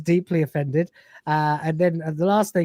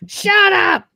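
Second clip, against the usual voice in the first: mock shout; 8 dB louder than usual. Quoting the Python sketch outburst.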